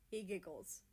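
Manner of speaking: plain reading voice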